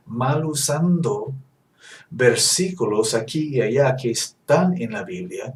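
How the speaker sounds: ordinary delivery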